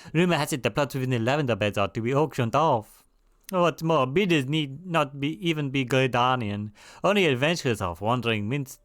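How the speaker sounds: put-on hammy French-accented quest giver; crappy French accent. Quest giver asking an adventurer about land auctions, crappy French accent. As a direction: do a dodgy French accent for a game character. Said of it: "doing this particular French accent".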